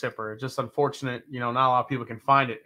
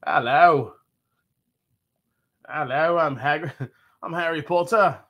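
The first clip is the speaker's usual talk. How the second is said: chain-smoker with a mock English accent; hammed-up English accent, grown-up smoker; badly faked English schoolboy gone old; adult Harry Potter; 3 dB louder than usual. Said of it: "adult Harry Potter that's been smoking".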